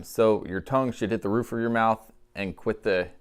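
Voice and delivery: ordinary talk, nothing marked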